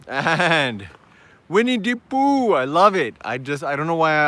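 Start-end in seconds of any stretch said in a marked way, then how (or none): 1.5-2.5 high, playful Jamaican lilt; Jamaican accent. Pretend. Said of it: "like, a Jamaican accent".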